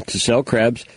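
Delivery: usual speaking voice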